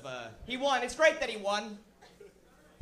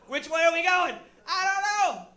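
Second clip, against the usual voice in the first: mock clueless; 8 semitones higher, 9 dB louder than usual. Mock confused America voice.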